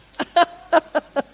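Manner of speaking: high, loud burst of laughter. Real, not acted. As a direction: laugh out loud at your own joke. Laughing at own joke.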